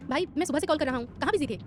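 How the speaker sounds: eager brother. Acted.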